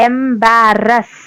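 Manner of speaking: Embarrass drawn out slowly with both syllables pressed; stressed slowly. Shown as a demonstration. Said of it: "രണ്ടും തറപ്പിച്ച് പറയാം". (Embarrass പതുക്കെ തറപ്പിച്ച്)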